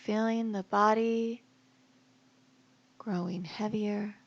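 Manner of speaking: guided meditation. Acted. Yoga teacher guiding listeners to relax each body part, guided meditation voice. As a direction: lead them calmly into rest, phrase by phrase.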